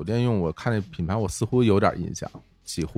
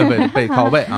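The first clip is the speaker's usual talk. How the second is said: laughing; 4 semitones higher, 7 dB louder than usual. Real, not acted. Laughing correction of wordplay.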